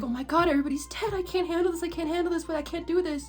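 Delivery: Paimon freaking out. Retold or imitated. Paimon panicking to the Traveler, a light mock of the panic. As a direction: fall apart in a panic like a little companion. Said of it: "Paimon freaking her shit out".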